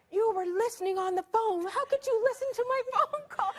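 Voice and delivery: high, loud accusing voice; mock indignation. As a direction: imitate an outraged brother, half laughing. Acts brother's accusation over eavesdropping.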